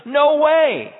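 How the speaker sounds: loud, high scoffing "no way"; scoffing disbelief. Acted. Sarah scoffing at the promise of a child, loud and high. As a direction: blurt it out like it's absurd.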